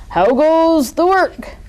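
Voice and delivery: high, loud, slow bossy call; lazy farmer shouting from bed; farmer's voice. A narrator's reading. Farmer voiced in read story.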